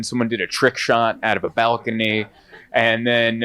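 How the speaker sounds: ordinary delivery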